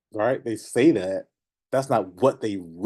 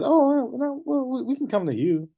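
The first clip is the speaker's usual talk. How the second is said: much higher, hammed-up eager offer; mock eager answer; 13 semitones higher than usual. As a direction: parody a nervous seller's reply. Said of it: "When somebody says".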